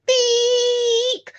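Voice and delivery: high, thin, drawn-out mock horn beep; imitating a weedy car horn, slow and high; pathetic horn beep. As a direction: squeak it like a feeble car horn. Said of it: "one of those really pathetic horns".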